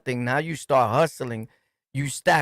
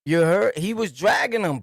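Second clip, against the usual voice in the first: ordinary delivery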